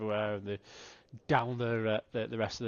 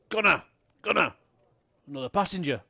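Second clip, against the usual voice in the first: put-on drunk man calling out; 6 semitones higher, 6 dB louder, faster than usual.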